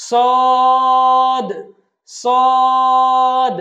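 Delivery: সদ loud and long, bounced final d; pushed final sound. Shown as a demonstration. Teacher models the letter, সদ long with pushed ending. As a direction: hold সদ level, then knock out the d. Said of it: "একটু ধাক্কা দিয়ে আমরা উচ্চারণ করব".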